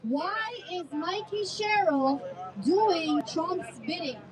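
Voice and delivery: each word drawn out slowly; slow it down and spell it out; slow and deliberate. Real, not acted. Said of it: "Say it again".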